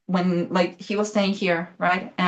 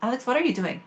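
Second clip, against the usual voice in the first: higher, softly puzzled quote; retold bewildered question; 5 semitones higher, 4 dB quieter, faster than usual. Wife questioning her husband on his phone, bewildered. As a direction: quote yourself puzzled at him, pitched up.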